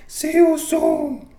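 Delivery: high-pitched, loud, drawn-out mock Romero accent. Host clowning as the fighter after the fight, badly faked Romero accent. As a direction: shout it slowly in a hammed-up high accent. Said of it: "I can't do the Romero, uh, accent really good".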